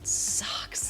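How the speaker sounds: breathy, slowed whisper of misery. Retold or imitated. To Tom on a sweaty set, a slow, miserable whisper. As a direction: whisper your complaint out of the side of your mouth. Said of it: "like that".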